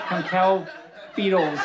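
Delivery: usual speaking voice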